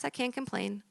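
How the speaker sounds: meek, uncomplaining patient voice; resigned refrain. Acted. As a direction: say it small and soft, as if nothing is wrong. Same patient, quiet refrain before outburst.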